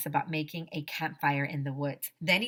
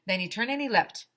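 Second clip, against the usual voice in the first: ordinary delivery, nothing marked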